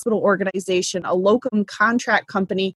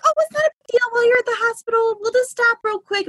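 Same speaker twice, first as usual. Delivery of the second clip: breezy buyer; 14 semitones higher, faster than usual. Retold or imitated. Buyer brushes off labor call.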